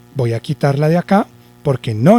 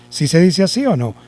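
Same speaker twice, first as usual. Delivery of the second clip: ordinary talk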